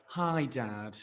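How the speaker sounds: low, soft, feeble greeting; pitiful sick cat. Retold or imitated. Cheeky cat returning ill to its owner, pitiful. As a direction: drop it right down, a poorly pet creeping back.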